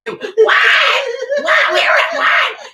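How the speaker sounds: high, loud "What? What?" in disbelief; incredulous staff yelling; shocked outcry. Retold or imitated. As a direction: shout it out in mock shock. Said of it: "They will".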